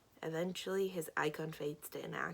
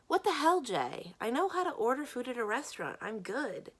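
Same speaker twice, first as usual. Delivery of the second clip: loud, high-pitched indignant snap; angry, raised voice easing off at the end; indignant outburst; 5 semitones higher, 4 dB louder than usual. Acted. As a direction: burst out offended at being babied. Acted quarrel, friend offended.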